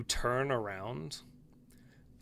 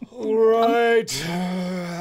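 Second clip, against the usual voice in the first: ghost voice; 5 semitones higher, 9 dB louder, much slower than usual. Acted. Ghost voice resumes.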